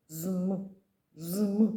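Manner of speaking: "Зм" hummed as bare consonants; no vowel. Shown as a demonstration. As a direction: press out "Зм" with no vowel. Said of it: "без голосних як буде?". ("Зм" без голосного)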